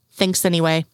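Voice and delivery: ordinary delivery, nothing marked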